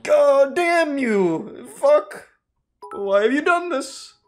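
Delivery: high, slow fist-shaking curse; sputtering foiled villain. Retold or imitated. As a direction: shake your fist and squeal the curse. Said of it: "shaking his fist in the air".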